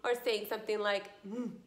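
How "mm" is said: a hummed "Mm" in place of the T; wrong sound. Shown as a demonstration. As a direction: hum "Mm" as the mistaken sound, not T. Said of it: "that's not T".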